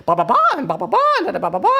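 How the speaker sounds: high gibberish scolding; mock nagging babble. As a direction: nag in nonsense syllables. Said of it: "then it goes like".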